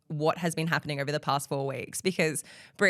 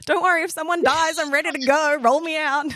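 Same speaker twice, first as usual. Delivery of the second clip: eager producer; 9 semitones higher, 4 dB louder than usual. Retold or imitated. Voicing the producer waiting eagerly.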